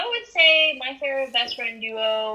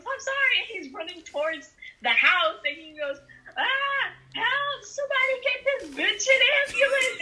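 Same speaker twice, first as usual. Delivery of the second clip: high character voice; 11 semitones higher than usual. Acted.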